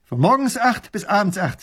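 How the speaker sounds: high, loud, proud report. A narrator's reading. Soldier stating his guard hours, proudly. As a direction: announce it with pride. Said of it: "sagt der Mann stolz".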